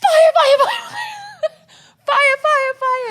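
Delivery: very high, loud yelled alarm; mock panic shout. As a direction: scream it like the room is burning.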